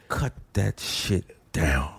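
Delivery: slow, low, deliberate order; imagined firm complaint, pitched low; measured demand. Acted. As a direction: spell it out slowly, word by word. What he wants to tell him.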